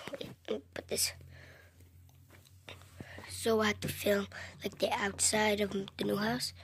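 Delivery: soft whispered aside; hushed planning. Real, not acted. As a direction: whisper off camera. Whispering behind camera.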